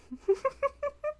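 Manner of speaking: high, breathy delighted giggle. Acted. Charmed by her lover's try, a high giggle.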